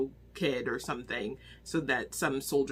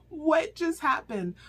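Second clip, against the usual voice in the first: calmer disbelief; 12 semitones higher, 4 dB louder, slower than usual. Real, not acted. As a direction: repeat it, still shaken but settling. Repeats reaction, calmer.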